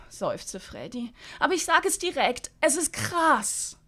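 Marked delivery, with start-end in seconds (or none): 1.4-3.8 put-on high, lively young woman; Freddy's voice. Freddy brushing off old TV hosts, high and breezy. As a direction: pitch it up, breezy and carefree.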